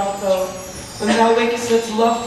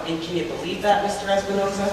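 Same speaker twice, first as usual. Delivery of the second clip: ordinary talk, nothing marked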